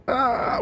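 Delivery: high, drawn-out "aah" without force; half-hearted wail of losing your mind; imitated high cry of going crazy; mock crazed scream. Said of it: "mental going crazy".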